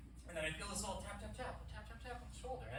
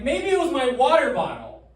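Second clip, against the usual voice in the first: eager excuse; 9 semitones higher, 15 dB louder than usual. Retold or imitated. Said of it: "I'm going like".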